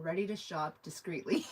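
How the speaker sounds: reading a chat line through a laugh; giggling while reading aloud; amused, laughing through the words. Real, not acted.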